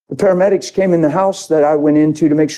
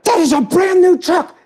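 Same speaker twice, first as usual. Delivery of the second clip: much higher, hammed-up tearful fret with a laugh; mock-tearful girl. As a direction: play the girl's panic half laughing.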